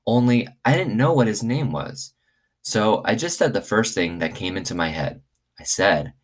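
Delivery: lively read-aloud for the class; story reading to children. Acted. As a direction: tell it like a bedtime story to kids. Reads chapter one aloud to class.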